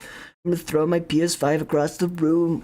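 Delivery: silly high voice. Pretend. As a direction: go way up into a giddy cartoon squeal. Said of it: "Why am I talking like this?".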